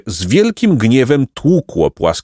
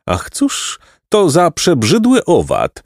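slow, higher-pitched cry of disgust; exclaimed cry; 7 semitones higher, slower than usual. A narrator's reading. Jacek exclaims at a repulsive insect, mild cry. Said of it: "krzyknął Jacek".

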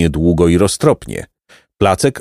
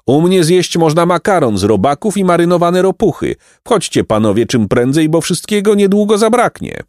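high hawker's call; 8 semitones higher than usual. A narrator's reading. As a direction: keep luring diners in. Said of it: "wołał trzeci".

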